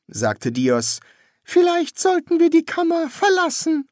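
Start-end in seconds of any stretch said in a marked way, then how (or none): 1.4-3.9 very high-pitched put-on priest voice. Priest addressing his young master, high put-on voice. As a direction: pitch the priest far above the narrator.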